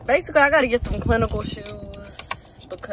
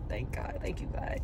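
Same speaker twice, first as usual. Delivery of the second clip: fading to a whispered prayer; grateful; 8 semitones lower, 11 dB quieter than usual. Real, not acted. Grateful student thanking God, fading to a whisper. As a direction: drop softer each time until a whisper. Quiet thanks after gushing.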